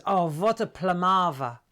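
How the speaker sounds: imitating mum's German accent, "what" as "vot"; mock German accent, emphatic. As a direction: exclaim it like a German mother. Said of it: "when you've got a German accent".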